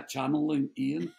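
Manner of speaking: usual speaking voice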